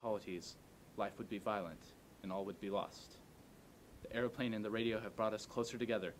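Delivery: ordinary delivery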